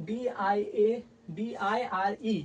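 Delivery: letters DIA and ডিআইআরই spelled very slowly; slowly dictating the letters to learners; slow spelling. Shown as a demonstration. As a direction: read out each letter slowly. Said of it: "এই যে কত ডি আছে".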